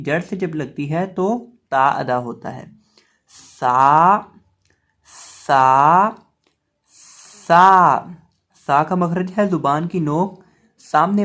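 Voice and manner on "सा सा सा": loud, drawn-out सा; letter drill. Shown as a demonstration. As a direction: model सा loudly with a long, held vowel. Letter drill, model recitation.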